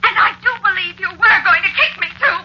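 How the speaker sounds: high, loud angry outburst; furious wife. Acted. Wife turning on her husband in a quarrel, furious shouting.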